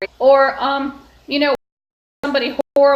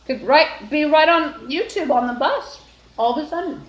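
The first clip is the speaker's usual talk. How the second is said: talking with a mouthful of muffin; words round a bite of food; mouth full; 3 semitones higher than usual. Real, not acted. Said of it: "I talked with my mouth full".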